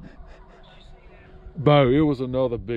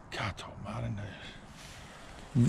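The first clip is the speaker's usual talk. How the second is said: hushed awe; 3 semitones lower, 11 dB quieter, much slower than usual. Real, not acted. Hushed awe over the downed deer.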